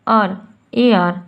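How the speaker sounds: ए आर spelled out slowly and low; demonstrating the air sound. Shown as a demonstration. Teacher sounding out letters for students, slow. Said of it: "sound क्या आ रहा है".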